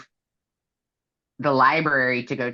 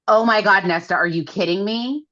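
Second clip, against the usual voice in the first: angry outburst; 6 semitones higher than usual. Retold or imitated. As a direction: retell his angry blow-up at her. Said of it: "He gets mad at her".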